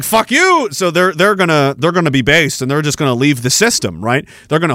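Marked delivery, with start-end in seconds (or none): 0.0-0.7 defiant quote. Retold or imitated. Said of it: "They're like".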